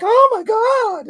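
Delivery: high, loud, slow mock shriek; mock alarm. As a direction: overblown panic over a fly. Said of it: "she'll be like".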